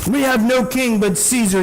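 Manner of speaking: mock crowd yell. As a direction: fling it back at the governor.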